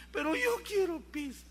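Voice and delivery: put-on a child digging in for pizza; insistent child. Child begging his parent for pizza, whiny.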